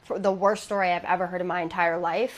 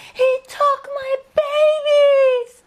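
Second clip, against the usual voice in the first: even higher, slower wailing scream, much louder, breaking into a cry; 9 dB louder, much slower than usual. Retold or imitated. Grieving mother screaming at old neighbours in McDonald's, wailing sobbing scream. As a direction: let the scream collapse into sobbing. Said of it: "and screamed".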